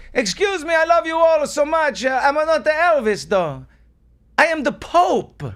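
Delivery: high, loud pleading Pope voice; shouting Pope. Acted. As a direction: shout over the crowd as the Pope. Pope corrects hotel crowd.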